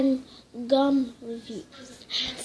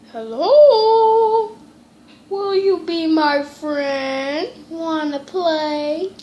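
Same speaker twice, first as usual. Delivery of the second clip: put-on creepy ghost child; 3 semitones higher, 8 dB louder, much slower than usual. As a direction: lure her in slowly and strangely.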